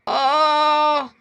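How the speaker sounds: long hammed-up moans between words; groaning in pain. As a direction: moan slowly over every sore spot. घायल कबाड़ी कराह रहा है. (दर्द से कराहते हुए)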